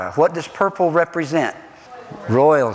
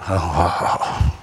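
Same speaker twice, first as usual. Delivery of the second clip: mentor's wince; 12 semitones lower than usual. Retold or imitated. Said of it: "a mentor who sits back there and goes".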